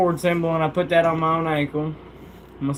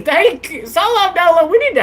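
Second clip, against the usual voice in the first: excited thanks; 13 semitones higher than usual. Real, not acted. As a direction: thank the viewer with a laugh.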